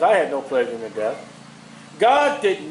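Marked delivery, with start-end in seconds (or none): 0.0-1.2 lightly voiced God. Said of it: "God says".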